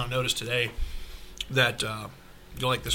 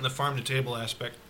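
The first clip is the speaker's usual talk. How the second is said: ordinary talk, nothing marked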